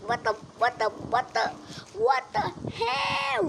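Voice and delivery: high, stretched stunned yelps in a put-on voice; shocked high exclamation. Stunned by the sight, high drawn-out shocked cries. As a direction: gasp it out high and slow in disbelief.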